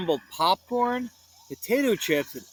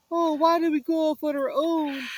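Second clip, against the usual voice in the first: sobbing; 11 semitones higher than usual. Acted. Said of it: "Shaggy sobbed".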